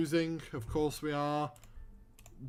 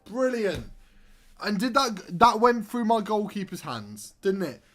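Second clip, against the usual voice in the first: louder, higher disbelieving complaint; incredulous outrage; 6 semitones higher, 7 dB louder than usual. Real, not acted. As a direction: protest the keeper error. Goal went through keeper.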